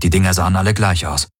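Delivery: ordinary delivery, nothing marked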